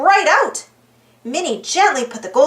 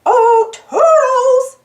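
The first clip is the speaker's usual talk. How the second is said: high, slow, loud sing-song call; 10 semitones higher, 5 dB louder, much slower than usual. A narrator's reading. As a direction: call out across the room, drawn out. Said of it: "Mickey called".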